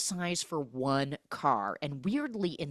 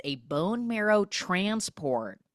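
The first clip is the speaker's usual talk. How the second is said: ordinary talk, nothing marked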